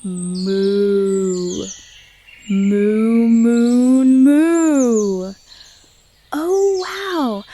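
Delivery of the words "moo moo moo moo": low, drawn-out cow moo. Shown as a demonstration. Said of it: "What does a cow say?".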